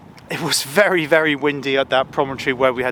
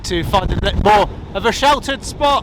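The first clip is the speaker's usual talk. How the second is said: shouting over the wind; 5 semitones higher than usual. Real, not acted. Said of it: "I do apologise for shouting".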